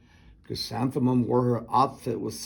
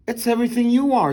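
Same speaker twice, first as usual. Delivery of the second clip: high, loud and fast gushing; mother gushes over her daughter's name; gushing praise; 9 semitones higher, 6 dB louder, faster than usual. A narrator's reading.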